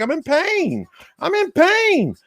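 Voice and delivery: high, hammed-up whine of pain; frail man in pain. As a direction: moan it out like a feeble hurting little man. Frail man tells his complaint.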